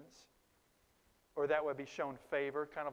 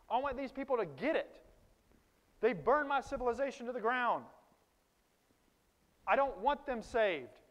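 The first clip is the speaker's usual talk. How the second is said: voicing angry Jonah; 4 semitones higher, 5 dB louder than usual. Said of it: "he would like to flee to Tarshish".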